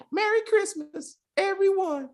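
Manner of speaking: put-on very high, slow little-boy voice; Tiny Tim. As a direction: draw it out as a squeaky child. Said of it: "Thank you, Tiny Tim".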